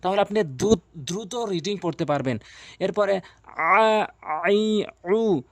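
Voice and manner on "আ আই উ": drawn-out vowels. Shown as a demonstration. Teacher demonstrating long vowels to viewers, slow and stretched. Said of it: "এক আলিফ টেনে".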